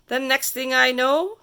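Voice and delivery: ordinary delivery, nothing marked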